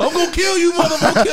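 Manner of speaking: mock screaming. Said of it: "started screaming at everybody".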